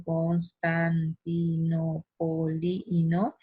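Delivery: constantinopolino drawn out slowly on a level pitch, each syllable separate; measured, monotone chant of each syllable in constantinopolino. Shown as a demonstration. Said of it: "son sílabas átonas".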